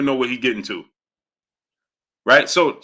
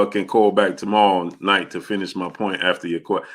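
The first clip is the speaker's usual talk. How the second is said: ordinary talk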